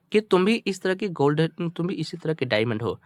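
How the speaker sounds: ordinary talk, nothing marked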